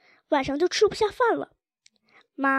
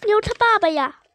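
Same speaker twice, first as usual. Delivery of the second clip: high, loud child's wail, lightly acted; tearful outburst; 6 semitones higher, 6 dB louder than usual. A narrator's reading. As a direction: blurt it out as if crying. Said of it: "就哭着说".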